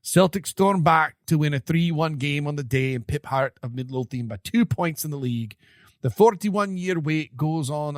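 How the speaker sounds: hammed-up Scottish brogue; Scottish accent. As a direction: read it like a Scottish pundit. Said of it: "it was hard in a Scottish accent to read".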